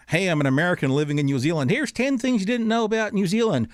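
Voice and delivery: hint of an American accent; lightly put-on American vlogger accent. Guest imitating an American vlogger in an American accent. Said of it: "sorry for my accent there".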